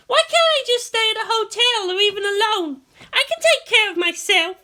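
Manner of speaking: put-on high, loud whiny girl. A narrator's reading. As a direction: complain stubbornly. Asuka complaining.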